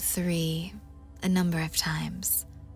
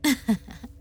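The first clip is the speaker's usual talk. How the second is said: teasing giggle; 6 semitones higher than usual. Acted. Teasing laugh in script.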